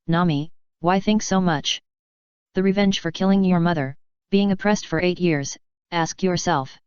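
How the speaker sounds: ordinary delivery, nothing marked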